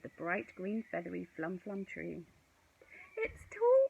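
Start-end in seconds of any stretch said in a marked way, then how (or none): 3.2-3.9 very high, loud exclaim; awed high cry; cried out. A narrator's reading. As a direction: marvel loudly at the tree. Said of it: "cried Rose".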